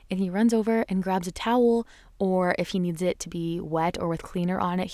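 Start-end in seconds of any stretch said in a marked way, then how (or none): none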